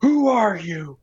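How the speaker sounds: high, startled mock panic; a hammed-up frightened question; panicked Clooney. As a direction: squeak it out like a paranoid man. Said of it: "it's like".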